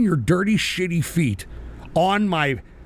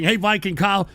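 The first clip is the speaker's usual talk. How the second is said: ordinary talk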